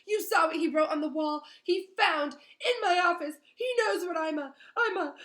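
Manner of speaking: high, loud ranting caretaker; frantic rant. Acted. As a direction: rant wildly, stumbling over words. Filch ranting, choking on words.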